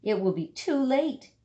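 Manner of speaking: ordinary delivery, nothing marked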